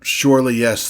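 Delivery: incredulous, forceful exclamation; exasperated outburst. Acted. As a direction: burst out in disbelief at the petty request. Demon exasperated by petty request.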